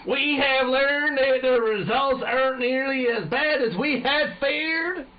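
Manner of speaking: high, loud, drawn-out American general; exaggerated American accent. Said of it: "It's a fucking American".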